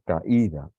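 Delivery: "Caída" with í strong and apart from ca; stressed syllable. Shown as a demonstration. Teacher pointing out the tonic syllable, stressed í. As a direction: hit the separate í in "Caída". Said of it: "es la sílaba tónica".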